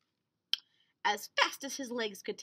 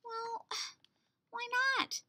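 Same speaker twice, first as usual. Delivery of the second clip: innocent question; 12 semitones higher than usual. Acted. Wilbur asks innocently.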